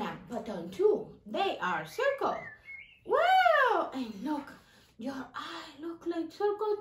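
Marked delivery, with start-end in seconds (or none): 3.1-3.9 much higher, louder mouse cheer; excited puppet mouse. Acted. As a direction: squeal with delight as the mouse.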